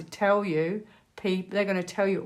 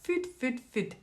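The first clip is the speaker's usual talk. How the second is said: imitated movie Scot, pitched high; high little Scottish mimicry; Scottish accent; 6 semitones higher, 3 dB quieter than usual. Vlogger quoting a line off a movie, attempted Scottish accent. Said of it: "another crack at the Scottish accent".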